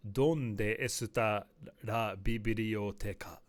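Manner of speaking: Spanish in an exaggerated Japanese accent, loud and slow; joking, loud, drawn-out Japanese-accented Spanish; heavy Japanese accent. Shown as a demonstration. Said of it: "in a very heavy Japanese accent in Spanish".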